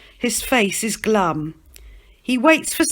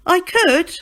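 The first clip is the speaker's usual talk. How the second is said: teasing Peter; 11 semitones higher, 5 dB louder than usual. Acted.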